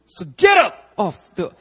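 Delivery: building from firm to a loud, high shout; rising to a fervent pulpit shout; rousing imitation of a fired-up preacher; Pastor Robert Daniels shouting. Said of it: "That's Pastor Robert Daniels".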